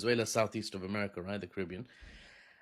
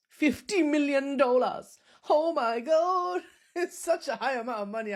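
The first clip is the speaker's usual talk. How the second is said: put-on Chinese accent; 13 semitones higher, 4 dB louder than usual. Agent announcing huge payout, put-on Chinese accent. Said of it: "I've gone Chinese".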